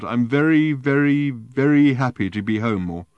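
put-on drunken happiness. Drunk Arthur slurring happily.

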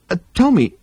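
hesitant second attempt, pitched high; nervous. A narrator's reading. Arthur retrying before staring crewmates, nervous high voice. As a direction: try tentatively to get the question out. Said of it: "he said again".

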